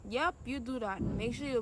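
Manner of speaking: normal talking voice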